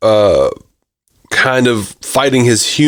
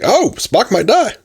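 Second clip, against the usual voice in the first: high-pitched pretend excitement; mock alarm; 11 semitones higher than usual. Fan imagining a character in danger, mock alarm. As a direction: play up a thrilled gasp of a reaction. Imagined viewer reaction to plot stakes.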